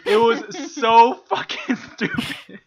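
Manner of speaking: slow, loud, high words through laughter; laughing. Real, not acted. Summing up the boss's blunder, helpless laughter. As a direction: crack up midway through the line.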